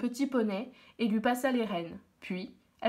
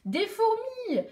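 surprised discovery; 11 semitones higher, much slower than usual. A narrator's reading. Girl discovers ants.

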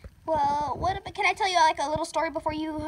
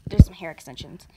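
whisper; 10 semitones lower, 4 dB louder than usual. Acted. Secret confession.

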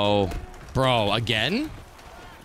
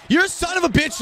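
angry shout; 13 semitones higher than usual. Real, not acted. Cursing his player, shouting it.

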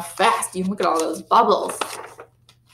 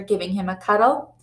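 usual speaking voice

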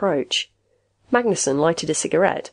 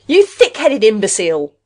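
loud, higher-pitched insult hurled; exasperated shout; 4 semitones higher, 8 dB louder than usual. A narrator's reading. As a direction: yell at someone being maddeningly stubborn. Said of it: "Rath shouted".

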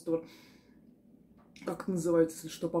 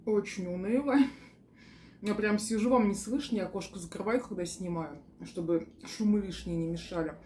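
speaking between bites; chewing while talking. Real, not acted. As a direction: mouth partly full. Seen 1: Bite at -5; cheeks slightly full, chewing-like lips while speaking.

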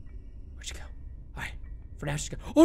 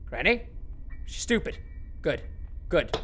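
normal talking voice

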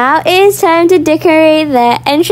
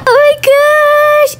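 high, drawn-out squeal of delight; slow high-pitched oh my gosh; excited squeal; 12 semitones higher, slower than usual. Real, not acted. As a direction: gush at the fancy room. First look at fancy hotel.

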